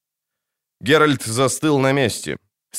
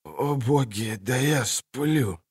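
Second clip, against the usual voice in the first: wounded soldier; 6 dB quieter, slower than usual. Acted. Wounded man in pit.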